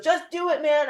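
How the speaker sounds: high urging push; impatient boyfriend. Retold or imitated. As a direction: urge him, impatient. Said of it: "He goes".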